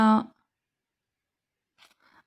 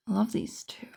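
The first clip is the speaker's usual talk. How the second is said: whispering; 8 dB quieter, faster than usual. Real, not acted. Soft admiring aside over cards.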